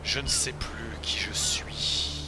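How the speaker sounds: slow whispered lost spirit; ghostly whisper. Acted. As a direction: breathe it like a lost soul.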